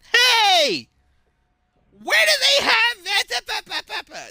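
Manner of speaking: high, loud shrill-voiced old lady yelling. Old lady shouting at a store worker, high and loud. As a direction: squawk it like a demanding old lady.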